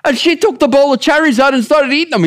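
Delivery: put-on high, fast Italian-accented griping. Comedian's husband complaining about the guest, Italian accent. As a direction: keep the comic Italian accent going. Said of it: "I'm trying to do my Italian accent".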